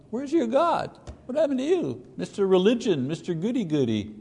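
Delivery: high, mocking taunt. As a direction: sneer it like a bully. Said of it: "There's the taunting".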